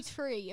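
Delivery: "tree" said with a plain t, no th; "tree" in place of three, t instead of th. Shown as a demonstration. Explaining her accent: "tree" said with t for th. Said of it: "when you say".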